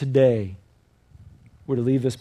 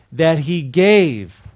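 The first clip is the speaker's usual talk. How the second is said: drawn out, louder, for the echo; slow call-out; 8 dB louder, much slower than usual. Shown as a demonstration. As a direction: stretch each word for them to repeat. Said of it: "One more time with an exclamation point".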